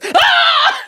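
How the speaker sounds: sudden high, loud yell; imitated scream. Storyteller imitates the screaming man heard from rock booth, high yell. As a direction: let out one abrupt high "Ah!". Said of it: "just yelling, screaming".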